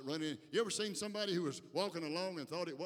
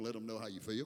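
usual speaking voice

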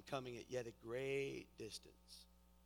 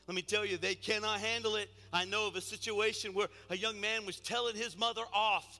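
raised, emphatic preacher's voice; forceful preaching; 9 semitones higher, 11 dB louder than usual. Real, not acted. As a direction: preach it to the whole room.

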